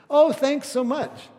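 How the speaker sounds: high, loud, slow gushing thank-you; imitating Joni's overflowing gratitude; bright gushing thanks. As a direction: thank her like it's the best gift ever. Joni thanks the borrower.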